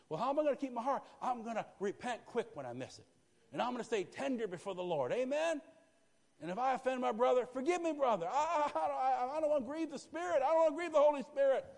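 high, loud preacher's climax; preaching at full force. Real, not acted. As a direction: cry it out to the congregation.

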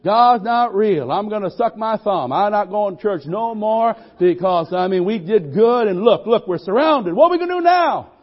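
loud, high panicky complaining; mock-panicked servant rising to a loud high cry; whining scared servant. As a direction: whine like a frightened young man. Preacher voices scared servant.

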